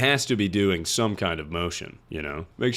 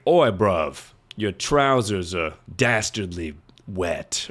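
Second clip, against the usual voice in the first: hammed-up, clumsy British accent; bad British accent; 2 semitones lower, much slower than usual. Therapist imagining the ginger guy in bed, bad British accent. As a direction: put on an unconvincing British accent, slowing down. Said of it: "That was supposed to be a British accent".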